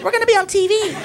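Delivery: high, squealy mock excitement; girls freaking out. Girls thinking they are on television, high excited squeal. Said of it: "they're freaking out".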